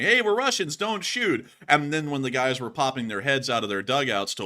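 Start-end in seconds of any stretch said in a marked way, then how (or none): 0.0-1.5 higher, louder mock shout; imitated yelling. Soldiers calling to the enemy dugouts, imitated shout. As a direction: yell it across the hill, pleading. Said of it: "They were literally yelling".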